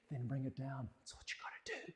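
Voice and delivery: breathy whispered aside. Real, not acted. Teacher whispering a tip to the gentlemen, whisper.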